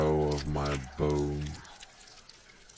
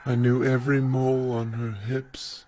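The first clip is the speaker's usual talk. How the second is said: raised, higher declaiming voice; heightened theatrical delivery, pitched up; stage declamation; 9 semitones higher, 5 dB louder than usual. Acted. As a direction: proclaim it like a stage monologue. Theatrical monologue reading.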